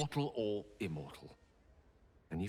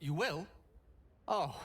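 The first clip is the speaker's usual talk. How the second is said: a high, hopeful question, suddenly thrilled; can't quite believe the yes; delighted surprise; 9 semitones higher than usual. Acted. Joyful surprise at the acceptance.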